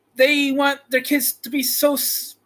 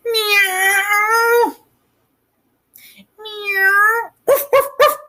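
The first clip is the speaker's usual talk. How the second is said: high meows then high barks; be the cat, then Toto barking; playful animal sounds; cat and dog noises; 7 semitones higher, much slower than usual. Retold or imitated. Child imitates cat and dog.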